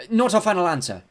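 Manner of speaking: high, loud, alarmed yelp. A narrator's reading. Girl almost stepped into a trap, yelping. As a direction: blurt it out to escape a trap. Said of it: "I yelped, realizing that we'd almost stepped".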